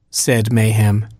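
ordinary delivery

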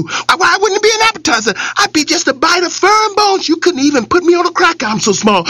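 high, fast pleading rabbit patter; fast-fire talking. Acted. Rabbit fast-talks the hungry lion, rapid pleading. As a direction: talk your way out, quick and eager. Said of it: "fast-fire talking".

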